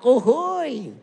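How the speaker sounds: loud, slow jubilant shout of praise; cheering congregation. Retold or imitated. The congregation cheers loudly in jubilant praise. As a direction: cheer it out like a crowd. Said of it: "Umatnya bersorak".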